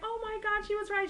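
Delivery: very high, excited squeal; amazed. Retold or imitated. Girl amazed the bartender guessed her friend's sign, high squeal.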